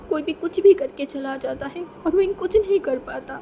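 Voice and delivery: plain reading voice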